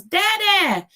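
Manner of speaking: high, loud toddler imitation; mimicked child's happy call; excited toddler. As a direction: call out like a little boy spotting his father. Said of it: "If his dad came, it was".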